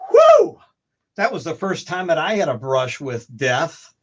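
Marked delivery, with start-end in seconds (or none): 0.1-0.6 relieved whew. Retold or imitated. Relief after surviving gunmen.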